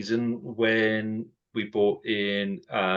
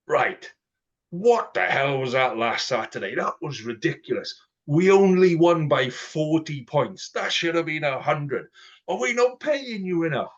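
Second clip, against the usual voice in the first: mock coach bollocking; 5 semitones higher than usual. Coach hauling the team into the changing room, mock bollocking. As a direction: tear into the players, hammed-up. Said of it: "This was".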